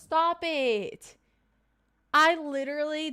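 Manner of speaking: real, excited astonishment; amazed disbelief. Real, not acted. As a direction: react as if you can't believe the coincidence. Amazed at viewer's pot roast dinner.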